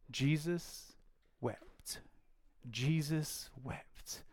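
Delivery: slow, soft, reverent; hushed scripture reading; hushed solemn reading. Acted. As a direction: let the verse sink in slowly.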